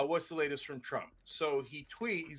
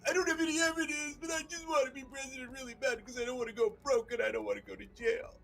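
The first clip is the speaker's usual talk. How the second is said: very high fake sobbing whine; mock crying Trump. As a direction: blubber like a crybaby. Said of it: "You stop your crying and your yapping".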